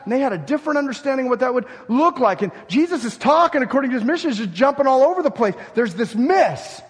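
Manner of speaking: fired-up preacher, rapid and loud; full-force preaching. Acted. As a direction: preach it hard and quick, then slam the brakes on the last line.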